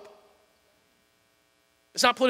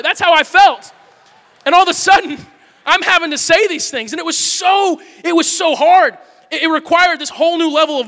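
loud, higher, pressing every word; impassioned preaching; 7 semitones higher, 7 dB louder than usual. Real, not acted. Pastor tells the church how hard it felt, shouting. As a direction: push it out at full preaching force. Preacher building about his struggle.

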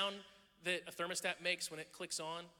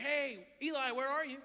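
higher, louder call across the yard; 8 semitones higher, 6 dB louder than usual. Retold or imitated. Dad calls his hiding son across the backyard. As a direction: call out to find the kid.

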